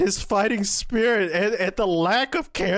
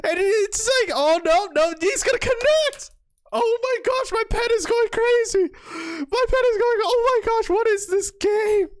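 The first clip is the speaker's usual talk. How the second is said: high, loud, overwhelmed commentary; giddy disbelief at full pitch; frantic amazement; 12 semitones higher, 3 dB louder than usual. Real, not acted. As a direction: squeal as if losing your mind. Frenzied reaction to the play.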